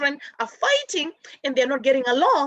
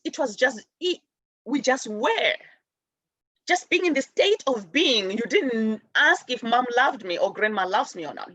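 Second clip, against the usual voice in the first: usual speaking voice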